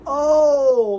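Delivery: high, loud "oh" bursting out; light up as it clicks; sudden eureka; excited realization. Real, not acted. Sudden realization about title.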